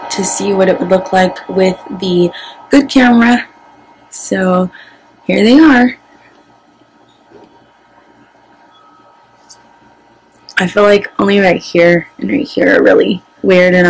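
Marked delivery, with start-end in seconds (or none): 5.4-13.9 lip pulled aside by finger; talking while showing teeth; finger in mouth. Real, not acted. Seen 1: Fingertip at mouth corner, pulling lip back over braces while speaking.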